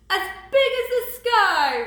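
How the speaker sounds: big, high roar as grandfather; booming storybook roar. A narrator's reading. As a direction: roar it out huge and drawn. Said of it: "roared Grandfather".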